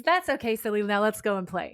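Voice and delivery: usual speaking voice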